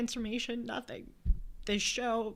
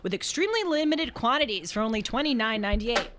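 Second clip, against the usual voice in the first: upbeat announcer ad read; lightly peppy ad voice; 4 semitones higher, 5 dB louder than usual. Acted. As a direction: sell it like a radio spot. Reads ad copy on air.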